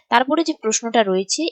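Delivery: usual speaking voice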